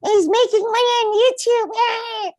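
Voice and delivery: very high, rasping witch cackle voice. Retold or imitated. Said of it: "you sounded like a witch and also like a motorcycle".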